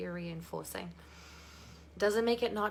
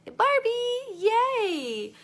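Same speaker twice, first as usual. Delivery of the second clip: high, loud drawn-out cheer; squealed, celebratory shout-out; excited cheer; 13 semitones higher, 8 dB louder, much slower than usual. Real, not acted. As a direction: cheer them on at a high pitch. Cheers a participant who is ready.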